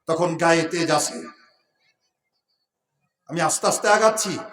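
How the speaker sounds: normal talking voice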